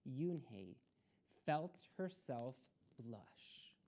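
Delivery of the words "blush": breathy whispered word. A narrator's reading. Narrator whispering about the girl blushing. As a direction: drop to a whisper for effect. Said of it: "storyteller whisper".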